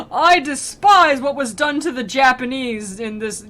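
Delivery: hammed-up aggrieved superfan; indignant fanboy. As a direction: declare it with pompous offence. Imitates indignant modern fan.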